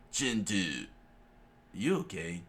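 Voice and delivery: usual speaking voice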